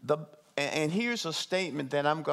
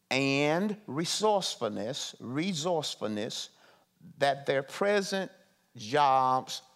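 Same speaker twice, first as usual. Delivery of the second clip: slow, deliberate reading with long pauses between words; measured, word by word, every item set apart. Shown as a demonstration. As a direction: spell the statement out slowly so the room takes it in. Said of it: "I'm gonna say it slow".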